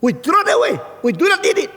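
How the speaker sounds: high, loud scornful outburst; yelling angrily. Retold or imitated. Dutch woman rejects Christianity, high angry yelling. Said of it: "dia teriak-teriak".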